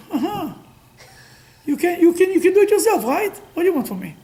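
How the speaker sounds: high, teasing told-you-so; mocking father. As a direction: rub it in, mock the boy who needed no one. Father mocks son's self-reliance.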